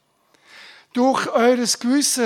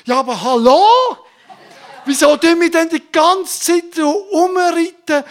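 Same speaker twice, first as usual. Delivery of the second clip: higher, louder, laughing disbelief; laughing exasperation; 5 semitones higher, 6 dB louder than usual. Acted. Preacher teasing the congregation, loud and laughing. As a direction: scoff with a laugh.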